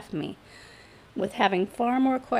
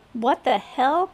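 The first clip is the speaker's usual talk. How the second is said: higher-pitched startled outburst; startled exclamation; 5 semitones higher, 4 dB louder, slower than usual. A narrator's reading. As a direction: exclaim it in alarm. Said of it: "I exclaimed".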